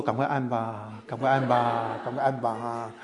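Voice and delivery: low, softened pleading under the breath; muttered urging. Retold or imitated. Walker privately hoping the lights go off, muttered urging. Muttered wish while walking.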